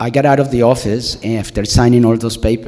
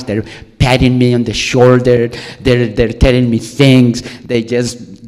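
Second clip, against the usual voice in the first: ordinary talk, nothing marked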